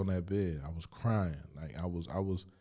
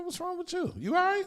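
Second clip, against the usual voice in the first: much higher put-on cellmate voice; voicing cellmate. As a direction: play the puzzled cellmate, pitched way up.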